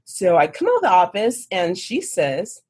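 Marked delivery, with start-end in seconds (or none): none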